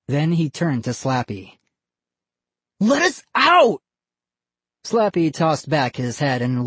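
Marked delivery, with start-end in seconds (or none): 2.8-3.8 high, loud cry to be let out; a frightened kid shouting for release; desperate shout. Acted. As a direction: yell it at the dummy, trapped. Trapped boys call to Slappy.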